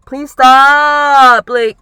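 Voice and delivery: fed up, begging him to calm down; exasperated plea. Retold or imitated. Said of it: "And I'm like".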